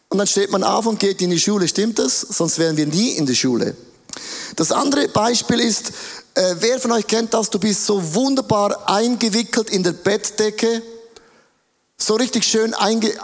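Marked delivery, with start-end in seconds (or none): none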